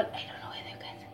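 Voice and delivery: quick whispered aside; whispering. Real, not acted. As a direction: whisper it fast to the camera. Aside to camera.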